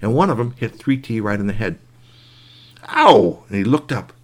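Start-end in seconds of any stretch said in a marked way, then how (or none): 2.9-3.4 loud yelp of pain; sharp ow. Acted. Boy hit by pine cone, loud yelp.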